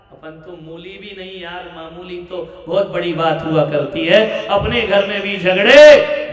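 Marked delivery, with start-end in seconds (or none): 1.8-6.3 louder, ringing preacher's point; rising loud emphasis. Real, not acted. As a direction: build it up and hit home.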